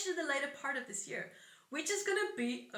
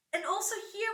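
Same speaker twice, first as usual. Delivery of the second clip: usual speaking voice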